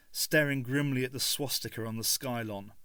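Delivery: usual speaking voice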